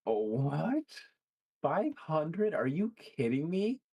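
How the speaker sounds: high-pitched shock at the price; can't believe what you just heard; stunned outrage at the cost; real shocked disbelief. Real, not acted. Shocked at five hundred dollar price.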